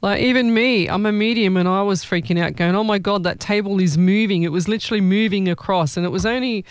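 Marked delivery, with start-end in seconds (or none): none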